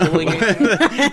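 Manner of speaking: giggling through the words; laughing. Real, not acted. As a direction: laugh while saying it. Laughing while telling story.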